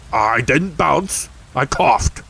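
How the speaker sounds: an offended tiger setting it straight; crossly. A narrator's reading. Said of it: "said Tigger crossly".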